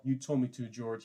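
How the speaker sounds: usual speaking voice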